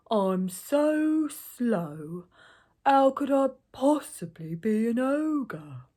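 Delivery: very slow, loud, stretched-out plea; slow ogre. A narrator's reading. Slow ogre protests his innocence to gatekeeper, very drawn out. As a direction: drag every word out as an ogre.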